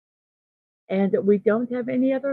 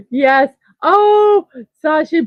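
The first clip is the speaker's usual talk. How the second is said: higher and louder, bursting with real delight; delighted squeal; 7 semitones higher, 8 dB louder, slower than usual. Real, not acted. Streamer greeting a favourite viewer, delighted and loud. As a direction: squeal with joy at a familiar name.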